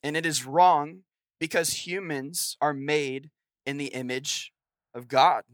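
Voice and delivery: normal talking voice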